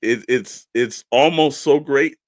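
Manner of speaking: normal talking voice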